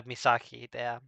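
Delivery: normal talking voice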